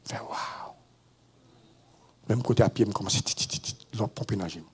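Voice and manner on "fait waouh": breathless whispered wow; hushed stunned wow. Real, not acted. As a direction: let the shock come out as a whisper. Reacting to the death sentence.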